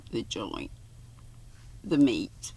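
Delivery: dropped low and soft, an aside. Real, not acted. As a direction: mutter the gloss under the text. Reader's aside, gloss.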